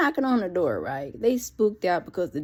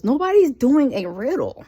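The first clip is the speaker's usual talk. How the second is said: exasperated; 5 semitones higher, 6 dB louder than usual. Real, not acted. Fed up with the riddles.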